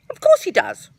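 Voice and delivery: high, loud boastful Tigger. Acted. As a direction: brag it like a bouncy tiger. Tigger answers Pooh eagerly.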